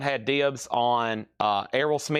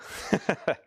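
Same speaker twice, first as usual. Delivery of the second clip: short breathy laugh; 5 semitones higher than usual. Real, not acted. Jokes about pushing his brain.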